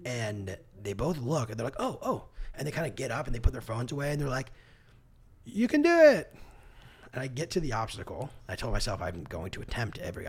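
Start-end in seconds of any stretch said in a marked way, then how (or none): 1.8-2.2 high, startled double oh; surprised oh. Retold or imitated. Imitating the bored staff suddenly seeing him arrive, high surprised. 5.4-6.3 high, loud encouraging yell; cheering shout. Retold or imitated. Imitating the obstacle staff cheering the limping runner, high loud yell.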